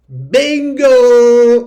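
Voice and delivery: bingo shouted high and loud; sudden burst of triumph; excited cheer. Real, not acted. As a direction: cheer like you just hit the jackpot. Breakout hits, cheering viewers on.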